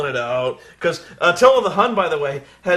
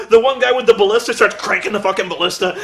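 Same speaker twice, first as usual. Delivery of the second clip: ordinary talk, nothing marked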